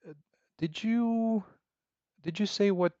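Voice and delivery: normal talking voice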